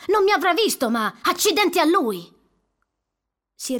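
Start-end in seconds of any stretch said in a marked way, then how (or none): 0.1-2.4 higher, louder furious outburst; angry curse. Acted. Douglas keeps cursing the driver, furious. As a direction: rage at the driver who nearly hit him.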